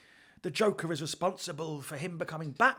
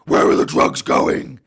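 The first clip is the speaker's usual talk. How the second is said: loud, slow rasping growl; 14 dB louder, slower than usual. Retold or imitated. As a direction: growl it like Bale's Batman.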